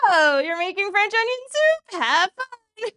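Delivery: high, loud mock reaction to soup news; Lucas. Cook imitates soup hater reacting to her plan, high and mocking. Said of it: "He was like".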